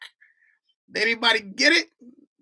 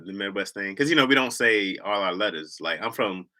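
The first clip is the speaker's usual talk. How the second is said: ordinary delivery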